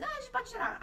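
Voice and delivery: mock doctor brushing it off; casual doctor. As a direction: toss it off like an offhand doctor.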